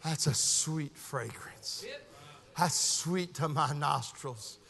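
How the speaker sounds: very soft, low, slow savoring; savoring a fragrance as God the Father. Acted. God leaning over heaven at Christ's sacrifice, savoring softly. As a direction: breathe it in, then murmur with pleasure. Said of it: "God the Father went".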